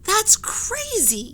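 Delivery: high, slow outraged cry; indignant yell. Acted. Jack yells at the sailors, high, slow and indignant. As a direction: yell it in disbelief. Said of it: "yelled Jack".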